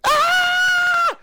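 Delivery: loud, very high shriek; high mock scream. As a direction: scream like a panicked nine-year-old. Retelling his scream at the bee.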